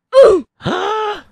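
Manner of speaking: loud, high gasp; sudden high intake of breath; sharp gasp. Real, not acted. Chance at goal in game.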